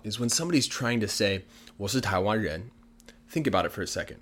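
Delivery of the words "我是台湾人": normal talking voice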